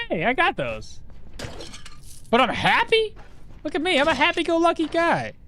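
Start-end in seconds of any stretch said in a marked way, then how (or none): 2.3-5.3 mock happy. Mock cheerful self-description.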